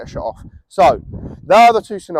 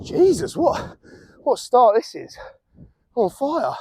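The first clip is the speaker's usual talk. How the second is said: excited; 6 semitones higher, 4 dB quieter, slower than usual. Real, not acted. Amazed at his own finish.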